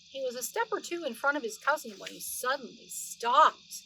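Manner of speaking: lively read-aloud storytelling voice; story narration. Acted. Grandma reading Beatrix Potter to the children, animated storytelling voice. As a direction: read it like a bedtime story for little ones.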